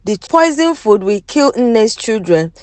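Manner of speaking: plain reading voice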